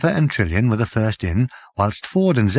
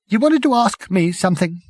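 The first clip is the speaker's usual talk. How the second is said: thin voice; 9 semitones higher than usual. A narrator's reading. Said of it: "in a thin voice".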